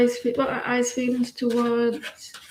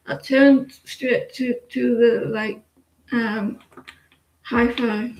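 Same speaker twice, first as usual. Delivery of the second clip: ordinary talk, nothing marked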